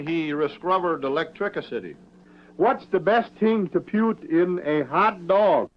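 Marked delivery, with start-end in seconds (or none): 2.6-5.7 slow high silly voice. Acted. Puppet-style riddle voice.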